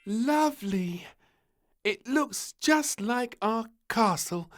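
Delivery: put-on high, slow little-girl voice full of wonder; admiring princess. A narrator's reading. Little princess admiring the sandcastle, high and slow. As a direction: gaze at it in awe. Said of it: "she said admiringly".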